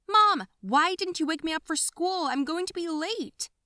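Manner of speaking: high, loud call through the house; panicked shout. Acted. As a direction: yell for mom in a hurry. Girl late for school.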